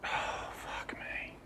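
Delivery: slow, hushed whisper of pleasure; awed whisper. Real, not acted. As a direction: whisper it slowly, savouring the sip. Savouring a sip of beloved whisky.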